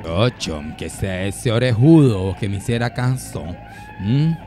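low-pitched character voice. Acted. Woman eyeing a man at the dance, low murmur. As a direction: drop way down and murmur like a smitten woman sizing him up.

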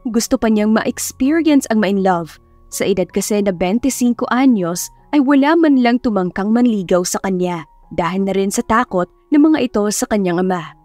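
usual speaking voice

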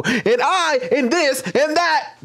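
a hint of Matt Walsh, drawn out and mocking; Matt Walsh impression. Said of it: "you'll hear him go".

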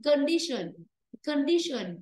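"condition" starting kuhn, stress on di; weak first vowel. Shown as a demonstration. Teacher corrects the class, "condition" with schwa first syllable. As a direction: reduce the con of "condition" to schwa. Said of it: "Phải đọc condition".

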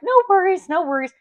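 high-pitched, easy brush-off of an apology; imitated friendly runner. Runner answers my apology as she passes, imitated high-pitched reassurance. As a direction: mimic her high "no worries", easing down on the repeat. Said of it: "she'd be like".

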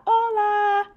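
put-on high, bright child's greeting. Girl answering the restaurant owner, high child voice. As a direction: say it back like a little girl.

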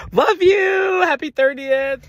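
very high, giggly squeal; squealed birthday cheer. Acted. Friend ending her silly birthday bit, high-pitched squeal. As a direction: send it way up high in a playful squeal.

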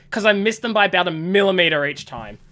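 usual speaking voice

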